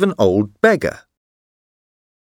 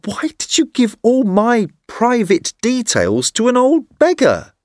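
put-on higher, upset young man voice; indignant young man; 11 semitones higher than usual. A narrator's reading. Hughie dismayed his details went to a beggar, higher voice. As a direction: protest to your friend, much higher.

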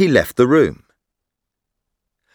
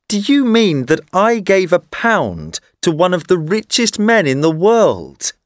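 put-on higher, horrified young man; dismayed young man; 6 semitones higher than usual. A narrator's reading. Hughie realising he tipped a rich man, higher voice. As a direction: ask it in disbelief, voice up high.

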